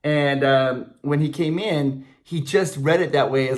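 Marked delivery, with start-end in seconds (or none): none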